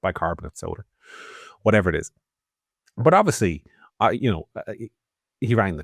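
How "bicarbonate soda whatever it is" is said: calm imagined reaction. Retold or imitated. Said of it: "your immediate reaction would be".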